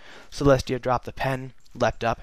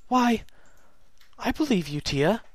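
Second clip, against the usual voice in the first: Luna voice; 10 semitones higher than usual. Acted. Luna's voice.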